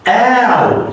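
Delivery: ow with the mouth stretched wide. Shown as a demonstration. Teacher modeling the vowel, exaggerated. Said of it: "stretch your mouth".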